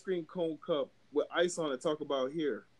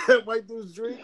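laughing; 6 semitones higher, 7 dB louder, slower than usual. Real, not acted. Laughing while telling Pulp Fiction bit.

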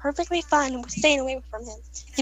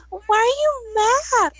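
imitating girlfriend; 8 semitones higher, much slower than usual. Girlfriend touching her hair.

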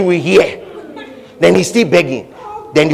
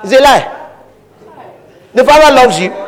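forceful rebuttal; 9 semitones higher, 5 dB louder than usual. Real, not acted. Preacher rebuts at full force.

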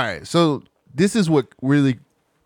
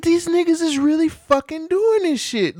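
disbelief; 13 semitones higher than usual. Retold or imitated. Retold disbelief.

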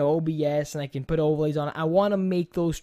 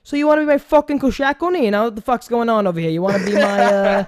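put-on over-the-top New York accent, pushed loud; exaggerated New Yorker; 3 semitones higher, 6 dB louder than usual. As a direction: ham up a New York accent while narrating Zombies. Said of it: "just say it like a New Yorker".

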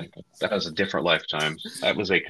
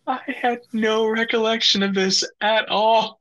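disbelief. Real, not acted. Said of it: "high slow disbelief".

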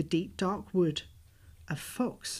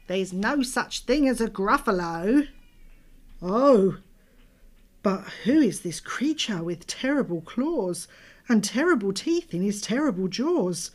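usual speaking voice

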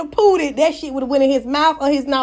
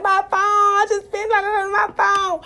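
mock crying; 7 semitones higher, slower than usual. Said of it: "boo hoo cried like".

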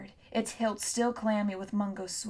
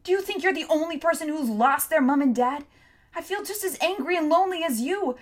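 high, loud furious tirade; angry tirade; 6 semitones higher, 6 dB louder than usual. A narrator's reading. Tom rants at Hester, high furious tirade. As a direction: let the anger out fully.

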